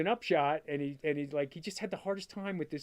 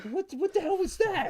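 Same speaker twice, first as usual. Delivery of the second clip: high, disbelieving what the hell; mock outrage, pitched way up; stunned dad reacting to a bad shot; incredulous; 3 dB louder than usual.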